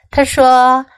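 plain reading voice

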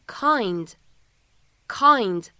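kind said slowly twice; slow demo read. Shown as a demonstration. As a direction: stretch out kind for the class. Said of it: "此时呢应该是kind". (慢读 kind)

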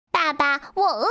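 high little duckling asking for food; hungry duckling. A narrator's reading. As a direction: plead in a small high voice. Hungry duckling speaks to fox. (小鸭子尖声喊饿)

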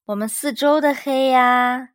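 loud, high cub voice; insistent little cub; Little Bear voice. A narrator's reading. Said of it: "小小熊说。".

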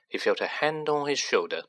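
plain reading voice

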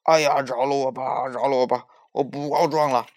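loud, pained begging; hammed-up wail of surrender; crying for mercy. A narrator's reading. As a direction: yell for help as if in pain. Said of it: "痛得龙王直叫救命".

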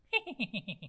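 轻而高的猴子式笑声; 孙悟空轻声连串地笑; 得意窃笑. Acted. 孙悟空得意地笑. (得意的一串轻笑)

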